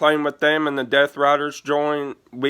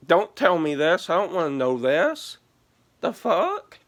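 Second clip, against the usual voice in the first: higher, half-laughing mock exasperation; exasperated protest; 6 semitones higher than usual. Telling her friend not to share the passwords, exasperated and higher. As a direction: push the secret away, pitch climbing to a squeak.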